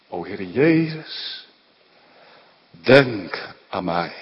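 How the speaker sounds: slow, drawn-out plea to Jesus. Acted. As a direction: draw out each word of the plea. Preacher voices the thief's cry. (langzaam smekend gebed)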